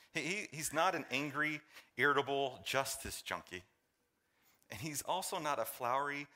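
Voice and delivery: usual speaking voice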